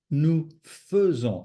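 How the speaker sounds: "faisons" carefully said as "fuh-zon"; schwa in faisons. Shown as a demonstration. Teacher correcting the mistake, "faisons" with an uh sound. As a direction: give "faisons" an uh in its first syllable, clearly. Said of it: "It's an E".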